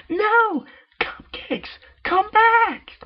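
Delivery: high, very loud desperate wail; Pinkie cries out. Acted. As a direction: call after the cupcakes in despair. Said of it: "Pinky cried".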